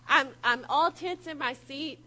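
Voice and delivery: ordinary talk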